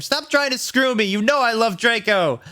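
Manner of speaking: high-pitched indignant shout; hammed-up protest. A narrator's reading. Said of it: "I shouted".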